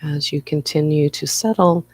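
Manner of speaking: guided meditation. Acted. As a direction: ease listeners into stillness, phrase by unhurried phrase. Leads a guided breathing meditation.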